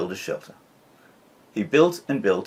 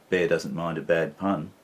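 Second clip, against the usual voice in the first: ordinary delivery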